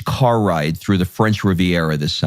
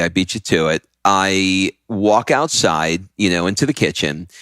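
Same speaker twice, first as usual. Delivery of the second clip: normal talking voice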